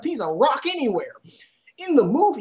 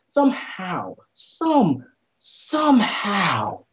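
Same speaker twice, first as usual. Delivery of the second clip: "somehow" stretched out slowly; drawn-out disbelief; 3 semitones lower, much slower than usual. Real, not acted. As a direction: repeat it, dragging the last "somehow" right out. Baffled at the botched story.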